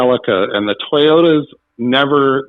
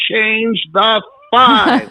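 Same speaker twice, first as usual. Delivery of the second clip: high, slow, deliberate mouthing with a laugh; urgent coaching; 9 semitones higher, much slower than usual. Retold or imitated. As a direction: spell it out to the contestant from the front row. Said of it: "and I was like".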